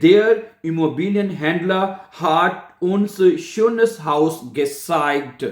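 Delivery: very slow, drawn-out German words; slow model sentence. Shown as a demonstration. Teacher reads out an example for learners, very slowly.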